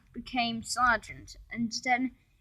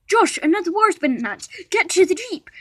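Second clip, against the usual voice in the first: fast, loud, higher panicked urging; 8 semitones higher, 8 dB louder, faster than usual. A narrator's reading. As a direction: rush it out like alarm bells. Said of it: "panicked the sergeant".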